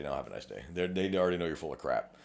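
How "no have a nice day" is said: a low, soft brush-off; dismissive brush-off. Retold or imitated. Recruiter dismissing a lying applicant, low and soft brush-off. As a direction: quietly show them the door. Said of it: "they're just gonna say".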